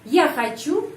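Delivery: хочу lifted sharply to make a question; question intonation. Shown as a demonstration. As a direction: pitch jumps up on the stressed end of хочу. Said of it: "apakah saya mau".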